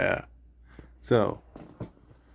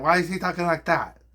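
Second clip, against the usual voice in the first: higher-pitched parody of the question; mocking echo; 6 semitones higher, 3 dB louder than usual. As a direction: echo it back in a silly raised voice. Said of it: "And I'll be like".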